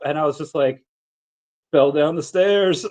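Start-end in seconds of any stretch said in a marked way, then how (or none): 1.7-2.8 slow, sheepish mumble of an excuse; sheepish reply. Retold or imitated. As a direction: say it slowly, a little embarrassed. His deadpan reply retold.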